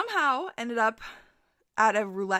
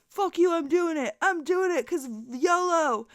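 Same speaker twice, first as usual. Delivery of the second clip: higher put-on voice, slow on the yolo line; drunk guy; 7 semitones higher than usual. Drunk Davis defying his friends, a higher reckless voice. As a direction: play the reckless drunk gambler.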